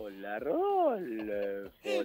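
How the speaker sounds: very slow, low, stretched greeting; saludo con voz impostada. Retold or imitated. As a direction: drag out each word in a put-on voice. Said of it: "se ríe como conmigo". (saludo grave y muy arrastrado)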